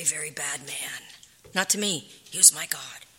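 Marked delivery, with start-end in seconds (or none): 0.0-1.0 whispered, hushed judgement. Real, not acted. Wife describing her husband to listeners, whispered. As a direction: drop to a whisper on it.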